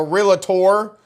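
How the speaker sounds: "realtor" drawn out as REE-luh-tor, slow; mock the wrong "realtor" as REE-luh-tor; wrong three-syllable realtor. Shown as a demonstration. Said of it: "tempted to put extra sylla-, syllables in there that don't belong".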